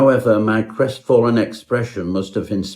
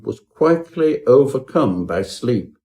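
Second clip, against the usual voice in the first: ordinary delivery, nothing marked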